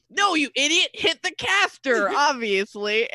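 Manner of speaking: exasperated order. Acted. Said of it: "cultist ... goes".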